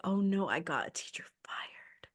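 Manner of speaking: whispered guilty "oh no". Retold or imitated. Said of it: "I felt so bad 'cause I was like".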